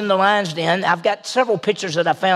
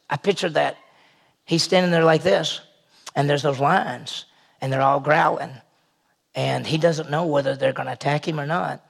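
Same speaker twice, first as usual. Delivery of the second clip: ordinary talk, nothing marked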